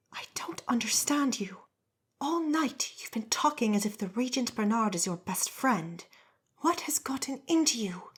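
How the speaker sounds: sharp whispered scolding; hissing whisper. Acted. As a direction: hiss it under your breath. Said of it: "the wife hissed".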